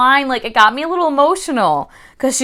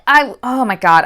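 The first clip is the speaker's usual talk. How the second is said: ordinary delivery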